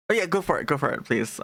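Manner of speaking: ordinary delivery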